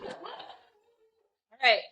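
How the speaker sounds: laughing as they wrap up, voice lifted high; laughing sign-off. Real, not acted. Signing off to viewers after Blanca calls time, laughing. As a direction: chuckle through the goodbye.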